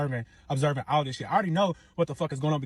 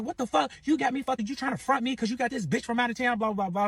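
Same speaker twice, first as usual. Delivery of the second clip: imitated arguing; 10 semitones higher than usual. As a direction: mimic her rattling off an angry complaint. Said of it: "So they arguing".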